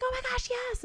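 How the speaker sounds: high, gleeful killer cheer; mock excited. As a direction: cheer like a delighted quizmaster. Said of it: "he's all excited".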